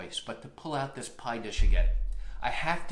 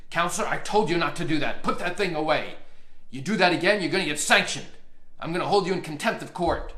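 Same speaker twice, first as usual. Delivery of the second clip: louder, stern judge yelling at counsel; retold judge's scolding; 3 semitones higher, 6 dB louder than usual. Judge yelling at the attorney to put it away. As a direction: bark the threat of contempt at the lawyer. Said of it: "He started screaming at me".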